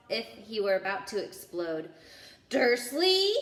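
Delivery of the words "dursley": booming name, much louder and higher. A narrator's reading. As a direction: bellow his name across the hut. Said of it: "he boomed".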